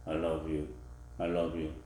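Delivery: low, soft unconvincing I love you. Shown as a demonstration. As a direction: say it without conviction. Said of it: "Who can believe that".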